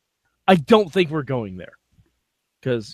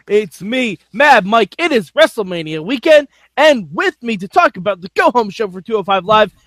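ordinary talk

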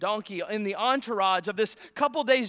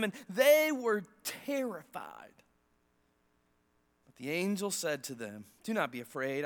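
scripture reading; 5 semitones lower, 3 dB quieter than usual. Acted. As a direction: read the Christmas story reverently. Reads the Bible passage aloud.

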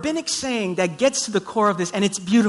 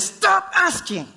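a hint of a stern parent, slow and higher; slow, higher scolding parent, lightly voiced; 11 semitones higher, slower than usual. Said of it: "where your parents said".

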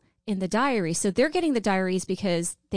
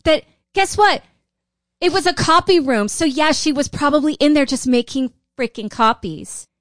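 indignant outburst; 5 semitones higher, 6 dB louder than usual. Real, not acted. Indignant debunking of the diary.

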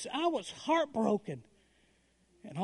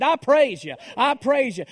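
louder shouted praise; loud joyful praise; 3 semitones higher, 6 dB louder than usual. Retold or imitated. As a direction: call it out running round the sanctuary. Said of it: "my volume got louder".

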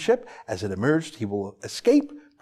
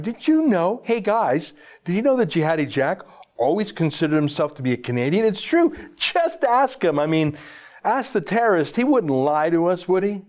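sarcastic mock wonder; 5 semitones higher than usual. Host mocking the terrorist's claim to his viewers, high sarcastic gush. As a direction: play wide-eyed innocence to mock him.

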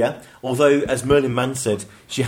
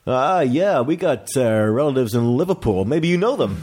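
imitated American; 2 semitones higher than usual. Teasing Americans who think the UK is tiny, mock American accent. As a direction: play a chatty American making small talk. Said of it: "you will get people come and say".

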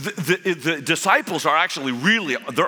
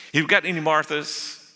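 normal talking voice

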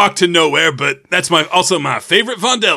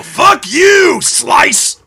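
shouting; 10 semitones higher, 4 dB louder, much slower than usual. Acted. Character's dramatic exit line.

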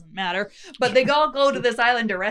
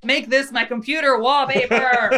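loud, slow gushing praise; hammed-up gushing over the movie; mock rave; 2 semitones higher, 5 dB louder, much slower than usual. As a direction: hype it up like an overjoyed fan.

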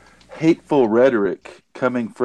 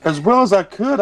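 incredulous echo; 7 semitones higher, 3 dB louder than usual. Retold or imitated. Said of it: "you defended yourself as well as you could".

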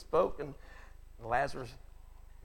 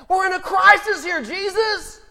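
panicked plea; 12 semitones higher, 10 dB louder than usual. Acted. Sisters panicking over Lazarus.

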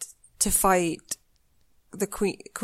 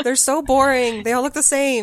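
whiny complaint; 8 semitones higher, 5 dB louder than usual. Real, not acted. Complaining about dungeons.

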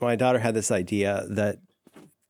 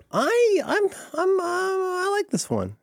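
silly voice; slower than usual. Pretend. Put-on high voice.

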